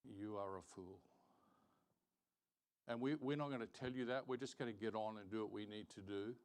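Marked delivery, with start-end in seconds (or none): none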